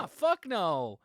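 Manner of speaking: loud, high, slow mock refusal; dismissive teacher. Voicing the professor, loudly refusing the student. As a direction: drag out a brash mocking no. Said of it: "And he's like".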